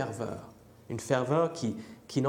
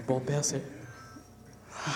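ordinary talk, nothing marked

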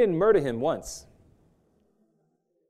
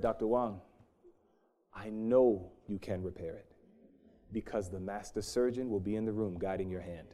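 lower, softer, calm certainty; quiet assurance; 6 semitones lower, 6 dB quieter than usual. Retold or imitated. Patient quietly reassuring his surgeon, lower and softer. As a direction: assure him gently, eye to eye.

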